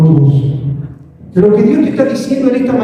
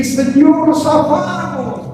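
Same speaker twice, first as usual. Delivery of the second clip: relieved; 6 semitones higher, much slower than usual. Retold or imitated. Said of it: "Viste cuando te toca".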